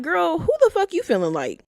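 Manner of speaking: high-pitched scandalized call-out; indignant disbelief. Retold or imitated. Viewer reacting to her remark, indignant disbelief. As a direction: challenge her, pitch jumping up.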